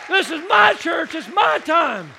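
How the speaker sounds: loud, drawn-out rally shout; preacher shouting with the congregation; shouted declaration. Real, not acted. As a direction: cry it out to the room. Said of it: "High five somebody and tell them".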